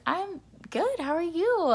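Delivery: high, loud cheery answer; cheery reply. Retold or imitated. Passenger answering the flight attendant, cheery and high. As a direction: re-enact a bubbly, high-pitched reply.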